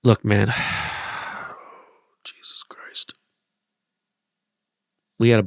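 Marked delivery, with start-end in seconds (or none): 0.5-1.5 long, breathy, soft sigh; heavy sigh. Real, not acted. Bracing to talk about the team's loss, heavy sigh. Weary before bad news. 2.2-3.1 whispered under the breath. Real, not acted. Dreading the team's loss, a muttered whisper. Exasperated aside after sigh.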